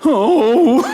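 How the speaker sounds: the boss caught completely off guard; stunned boss. Retold or imitated. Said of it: "you just hear it in [name]'s voice".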